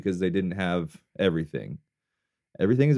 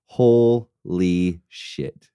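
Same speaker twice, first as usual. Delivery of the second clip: awed; 4 semitones lower, 3 dB louder, much slower than usual. Real, not acted. Awed reaction to match.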